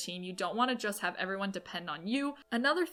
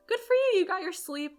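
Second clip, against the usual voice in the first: much higher, louder cheerful praise, easing off at the end; cheering friend on; 11 semitones higher, 7 dB louder than usual. Retold or imitated. Reassuring her friend Georgie about starting late, bright high cheer. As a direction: burst of delighted encouragement. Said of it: "I'd be like".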